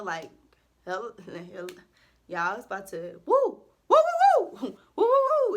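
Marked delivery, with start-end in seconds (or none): 3.2-5.5 high, loud hype woos; giddy high woos with a laugh; excited whooping. Real, not acted. Hyped whooping about adulthood.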